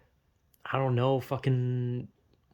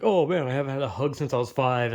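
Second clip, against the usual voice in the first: mock joke; 2 semitones lower than usual. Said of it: "I made the joke about how".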